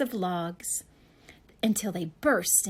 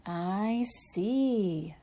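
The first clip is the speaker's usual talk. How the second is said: gravely. Acted. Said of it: "said the doctor gravely".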